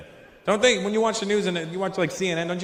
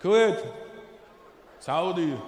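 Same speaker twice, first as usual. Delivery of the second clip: ordinary talk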